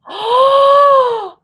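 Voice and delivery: loud, high-pitched gasp; excited gasp. Real, not acted. Letter reader struck by a realization, excited gasp. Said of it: "I got a little bit excited".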